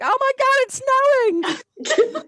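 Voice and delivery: high, loud excited squeal; mock shriek of amazement; freaking out. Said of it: "I was, like, freaking out".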